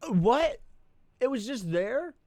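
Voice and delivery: high, loud disbelief; surprised. Real, not acted. Streamer surprised the item was just lying there. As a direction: react in loud shock.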